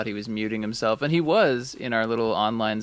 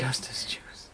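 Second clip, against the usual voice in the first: whisper; 9 dB quieter, slower than usual. Real, not acted. Repeating the drink name.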